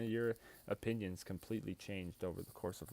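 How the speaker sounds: ordinary talk, nothing marked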